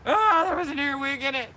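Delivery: calmer shocked retelling. Real, not acted. Repeats it after calming a little.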